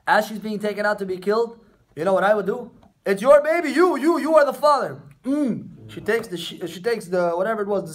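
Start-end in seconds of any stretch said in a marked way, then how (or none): 3.1-5.0 raised, louder accusing voice for Tamar; mock accusation. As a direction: point the finger and declare it loudly. Acting out Tamar accusing Yehuda.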